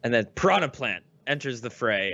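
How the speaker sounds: normal talking voice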